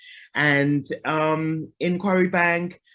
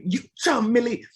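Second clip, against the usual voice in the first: imitating dad; 7 semitones higher than usual. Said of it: "my dad always say".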